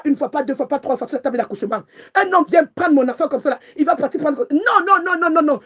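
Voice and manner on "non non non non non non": emphatic refusal. Real, not acted. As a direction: refuse again and again, rising in anger. Refusing, defending her child.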